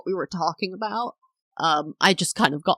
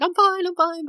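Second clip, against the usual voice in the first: very high-pitched, acted near-crying squeak; mock tearful; 13 semitones higher than usual. Wife insisting to husband she is okay, mock tearful. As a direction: squeal it as if fighting back sobs. Retold upset call to husband.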